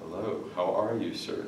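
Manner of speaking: quiet, stiff "Hello, how are you, sir?"; stiff formal greeting. Shown as a demonstration. Meeting a girlfriend's father for the first time, quiet and stiff. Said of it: "your spine is stiff".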